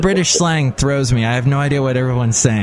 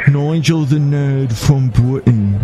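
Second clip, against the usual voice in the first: Nigel, British nerd; 2 semitones lower than usual. Pretend. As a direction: labour through a hammed-up British accent. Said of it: "It should be like a British accent".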